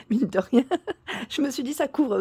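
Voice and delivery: real voice break, high and laughing; cracking voice. Real, not acted. Vlogger justifying the shorts to viewers, voice cracking with laughter. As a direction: let the voice crack upward into a giggle. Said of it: "ma voix, elle est cassée".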